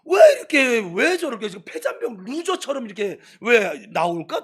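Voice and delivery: exasperated outburst, starting high and loud, then easing; frustrated. Retold or imitated. Young believer wondering at defeated churchgoers, frustrated. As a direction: cry out in baffled frustration, then settle. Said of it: "제일 답답한 거였어요".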